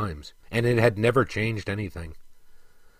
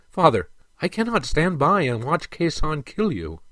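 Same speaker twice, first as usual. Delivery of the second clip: higher-pitched, earnest appeal; pleading protest; 5 semitones higher than usual. A narrator's reading. Prince unable to watch his father be killed, pleading. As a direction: appeal to him with lifted pitch.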